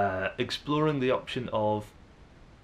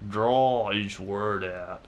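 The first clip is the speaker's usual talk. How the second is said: Southern drawl; 3 dB louder, much slower than usual. Shown as a demonstration. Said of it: "talk a little like this".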